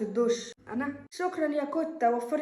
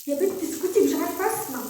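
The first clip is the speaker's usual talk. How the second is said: ordinary delivery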